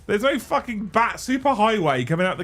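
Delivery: loud, high-pitched rant; incredulous protest. Real, not acted. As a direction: argue back in loud disbelief. Mock quarrel over the fact.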